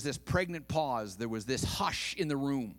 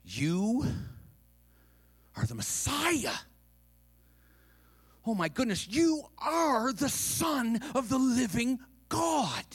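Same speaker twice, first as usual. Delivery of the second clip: blurted revelation; 9 semitones higher than usual. Acted. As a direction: build from a halting "you" to a ringing proclamation. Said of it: "blurted out".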